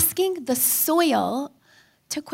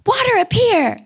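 higher, louder sing-song counting; coaching mom; 9 semitones higher, 6 dB louder than usual. Retold or imitated. Mother calming her screaming son, high loud counting. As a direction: count it out to break a tantrum. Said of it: "I'm like".

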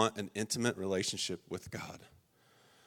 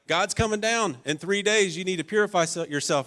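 higher and louder, bursting with news; excited Moses; 7 semitones higher, 4 dB louder than usual. Retold or imitated. Moses telling the people God is coming, excited and loud. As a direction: announce it like an eager messenger. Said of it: "he's like".

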